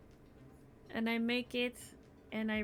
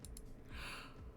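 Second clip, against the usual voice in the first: yawn; 14 dB quieter than usual. Real, not acted. Yawns; next names yawnies.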